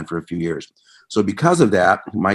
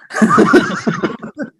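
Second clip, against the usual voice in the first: a high, loud burst of real laughter; a real burst of laughter at a friendly put-down; big laugh; 6 dB louder than usual. Real, not acted. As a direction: break out laughing at the punchline.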